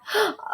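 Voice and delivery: sharp high gasp of alarm. Acted. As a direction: gasp in sudden fright. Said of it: "cried his mother".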